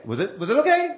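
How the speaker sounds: high, anxious mock question; acted nervous checking. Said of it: "now, now I'm nervous".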